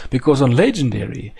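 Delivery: ordinary delivery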